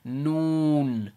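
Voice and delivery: "Noon" with a long oo, tongue forward; Arabic noon. Shown as a demonstration. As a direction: draw out "Noon" to a falling n. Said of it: "We put our tongue here again".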